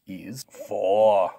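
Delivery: loud, stretched strongman answer. Pretend. Strongman takes the review quiz, answering loudly. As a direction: belt out the answer with confidence. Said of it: "Take it away".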